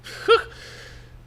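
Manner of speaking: sudden breathy burst of laughter; short laugh. Real, not acted. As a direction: let out one quick laugh. Amused by a viewer's comment.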